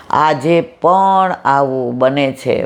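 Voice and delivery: slow, drawn-out declaration; slow refrain with stress on પણ; slow emphatic refrain. Shown as a demonstration. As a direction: stretch it out, leaning on પણ. Refrain repeated slowly, then fading.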